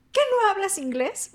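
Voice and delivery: high, loud retold indignant question; annoyed customer. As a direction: imitate an irritated customer demanding an answer. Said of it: "la señora más se enojaba".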